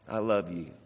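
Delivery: a hushed loving echo; soft tender repeat. Shown as a demonstration. Repeated softly, far, low.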